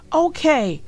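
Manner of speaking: put-on boy giving in; boy sighing okay to sister. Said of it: "said Arthur".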